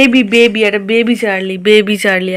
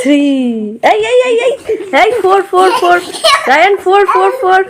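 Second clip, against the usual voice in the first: loud, high cheering with a laugh; excited cheering; 9 semitones higher, 8 dB louder than usual. Real, not acted. Mother cheering child counting sips. (উচ্চস্বরে হাসিমুখে উৎসাহ দেওয়া)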